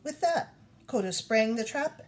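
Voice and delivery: ordinary delivery, nothing marked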